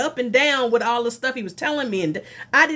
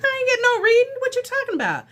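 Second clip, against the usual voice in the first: much higher, louder outraged question; outraged at the bill; indignant disbelief; 14 semitones higher, 3 dB louder, faster than usual. Retold or imitated. Billed for a reading she never got, outraged high disbelief.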